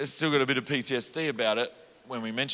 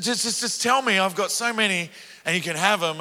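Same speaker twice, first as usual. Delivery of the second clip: high, louder pleading mum; insistent mum; 7 semitones higher, 3 dB louder than usual. Retold or imitated. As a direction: press your son to tell you. Mother voiced insisting.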